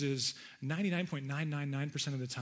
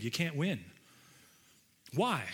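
normal talking voice

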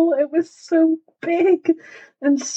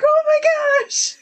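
much higher, drawn-out mock gasp of wonder; amazed child's reaction; 13 semitones higher, slower than usual. Mother retelling her child's reply to the news, amazed. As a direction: imitate a thrilled child, laughing.

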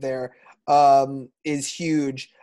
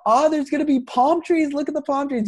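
high-pitched, hammed-up East Coast mom; comic impression of a hysterical mom; East Coast eighties mom; 10 semitones higher, 3 dB louder than usual. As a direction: gush like an excitable mother, pitched way up. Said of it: "East Coast eighties mom".

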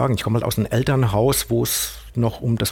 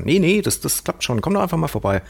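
higher-pitched, brushing doubts aside; retold casual encouragement, pitched up; breezy reassurance; 7 semitones higher than usual. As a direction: lightly imitate a friend coaxing you along. Friend waves away the speaker's doubts.

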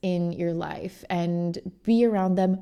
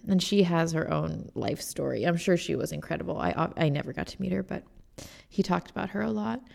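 ordinary talk

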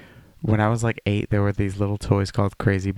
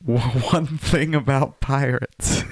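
words breaking into laughter; laughing; 7 semitones higher, 4 dB louder than usual. Real, not acted. Telling co-host about pirates, laughing. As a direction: laugh through the line.

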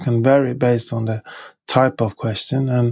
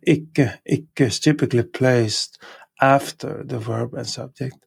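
ordinary talk, nothing marked

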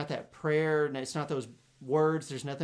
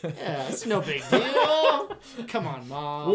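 laughing mock kid; 3 semitones higher, 7 dB louder, much slower than usual. Laughing mock of boy's line.

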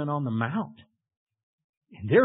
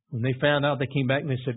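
usual speaking voice

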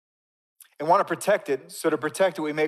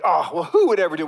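loud, higher mock disbelief; scoffing; 4 semitones higher, 11 dB louder, faster than usual. Preacher mimics us judging rule-makers, loud scoffing. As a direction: scoff loudly, as if above all that. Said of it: "We all scoff, like".

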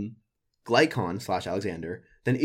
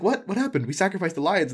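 mock bewilderment; 8 semitones higher than usual. Acts out the baffled crowd.

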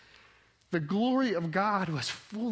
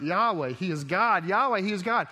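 louder, rising cry of worship; growing shout of praise; crowd crying out; 4 semitones higher, 7 dB louder than usual. Retold or imitated. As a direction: call it out like a crowd in awe. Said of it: "I mean, they cry out".

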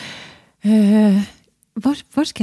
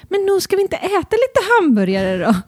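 coaxing parent; 9 semitones higher than usual. Retold or imitated. Coaxing child, retold.

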